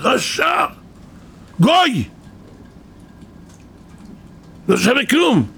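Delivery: high, punchy exclamations of scorn; indignant denunciation. Acted. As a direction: hurl each insult as a separate outburst. Preacher hurls insults at Balaam. (גינוי נזעם בקול גבוה)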